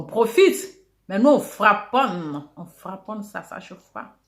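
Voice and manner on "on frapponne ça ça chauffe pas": whispered aside. Real, not acted. Hushed tag line to viewers.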